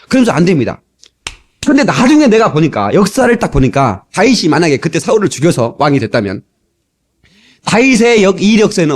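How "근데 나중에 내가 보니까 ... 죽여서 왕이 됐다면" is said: ordinary talk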